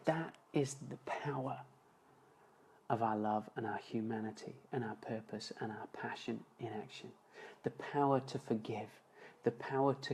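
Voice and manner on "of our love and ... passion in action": a hushed whisper on the big idea. Real, not acted. Speaker to audience, on love's power, whispered. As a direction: breathe it out, hushed and intent.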